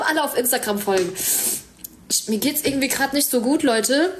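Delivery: ordinary talk, nothing marked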